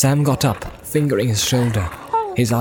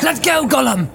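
high-pitched urgent command; commanding Frodo. Acted. Frodo ordering Gollum to let go of Sam, high urgent command. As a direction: order him off sharply, pitch up.

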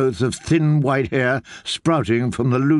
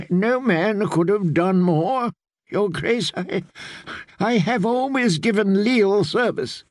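put-on frightened old maester; 6 semitones higher than usual. A narrator's reading. Old maester excusing himself to the queen, lightly higher. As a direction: plead like a cornered old scholar. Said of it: "Pycelle voiced by narrator".

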